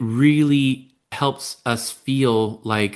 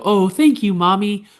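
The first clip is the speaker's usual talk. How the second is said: high, louder mock-sweet kid voice; grateful child; 9 semitones higher, 4 dB louder than usual. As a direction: thank mom like an overly pleased child. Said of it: "they might not immediately go".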